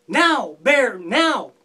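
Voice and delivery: loud shouted call to Bear; holler. Acted. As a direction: yell it out across the ice. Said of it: "I'm gonna holler out".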